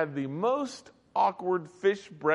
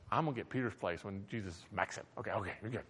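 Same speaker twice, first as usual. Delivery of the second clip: scheming aside; 8 semitones lower, 13 dB quieter, faster than usual. Retold or imitated. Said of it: "they're like going".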